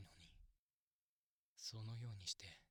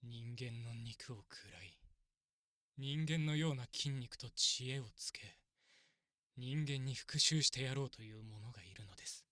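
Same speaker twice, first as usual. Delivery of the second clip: intense; 8 dB louder than usual. Acted. Describing vengeful extremist elves.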